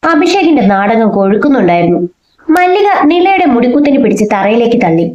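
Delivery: plain reading voice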